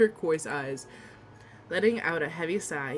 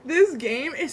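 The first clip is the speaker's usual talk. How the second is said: laugh-crying; 6 semitones higher, slower than usual. Real, not acted. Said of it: "I'm tearing up".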